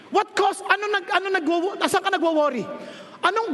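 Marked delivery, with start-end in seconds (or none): none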